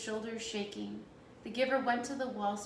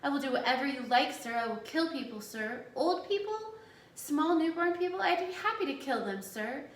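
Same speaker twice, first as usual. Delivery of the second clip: higher, louder mock servility; cruel sarcastic mimicry; 5 semitones higher, 5 dB louder than usual. A narrator's reading. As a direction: build the obedient parody to a high, loud pitch. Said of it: "Jonas mimicked in a cruel, sarcastic voice".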